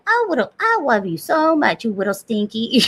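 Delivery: put-on dote on him like a toddler; baby talk. Mother cooing to her son, lightly. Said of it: "I've been talking to him like".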